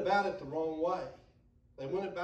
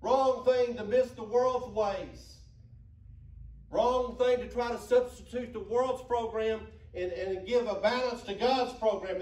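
loud, higher, rising sermon cadence; full-force preaching; 6 semitones higher, 6 dB louder than usual. Acted. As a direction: hammer the point home to the church.

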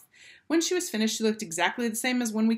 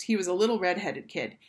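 ordinary delivery

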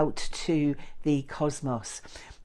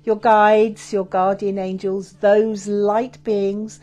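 guided meditation; 3 semitones higher, 5 dB louder than usual. Acted.

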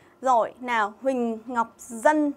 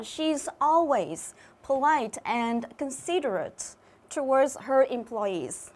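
slow reading aloud; much slower than usual. Shown as a demonstration. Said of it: "teacher reads the English sentence".

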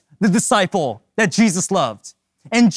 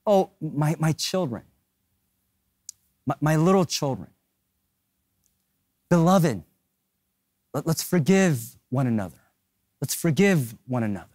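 hushed, lower, halting father figure; gentle aged John; 3 semitones lower, 4 dB quieter than usual. Acted. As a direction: speak tenderly to your little children, with long pauses. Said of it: "He talks like this".

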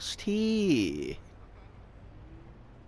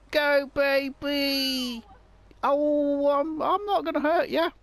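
hammed-up creepy taunt; playful menace; 9 semitones higher than usual. Playfully chasing the running woman.

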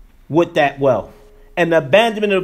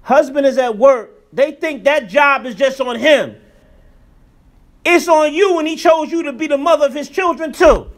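raised, urgent preacher's call; preaching at full force; 7 semitones higher, 3 dB louder than usual. Acted. Imam preaching to the sisters, raised and urgent. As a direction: press the point hard to the congregation.

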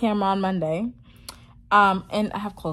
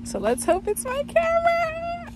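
high, drawn-out hopeful sing-out; hopeful squeal. Real, not acted. Vlogger hoping the package holds the camera, hopeful squeal. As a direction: stretch the last word out way up high.

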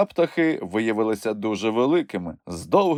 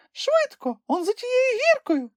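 very high, squeaky little creature voice; a tiny animal piping up in excitement; Kulka exclaiming. A narrator's reading. Kulka tells Grampus they are almost there, squeaky excited cry.

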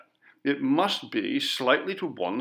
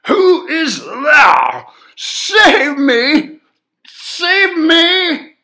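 very high, very loud, slow desperate crying scream; tearful scream; 16 dB louder, much slower than usual. A narrator's reading. Terrified man begs someone to save him, screaming and crying. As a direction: scream for rescue through tears. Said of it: "with tears streaming down his face, cried".

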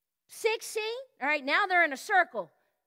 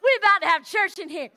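loud, joyful cry to the Lord; excited shout; 2 semitones higher, 7 dB louder than usual. Retold or imitated. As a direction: shout it out, overcome with joy.

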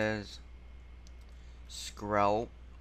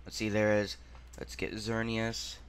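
ordinary talk

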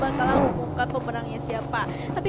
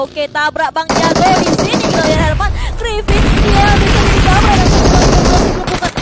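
loud, high hype call; rising shout as the kill lands; excited caster call; 5 semitones higher, 11 dB louder than usual. Real, not acted. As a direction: roar the play like a big highlight. Caster hyping a vehicle kill.

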